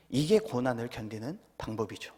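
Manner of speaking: ordinary talk, nothing marked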